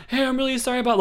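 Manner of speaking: high, pleading mock sorry; a hammed-up apologetic husband; mock apology.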